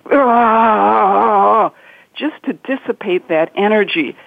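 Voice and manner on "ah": short, raised cry of release; venting cry. Shown as a demonstration. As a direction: shout out the pent-up energy. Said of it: "shake it on its hinge".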